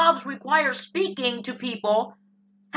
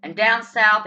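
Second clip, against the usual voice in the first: ordinary talk, nothing marked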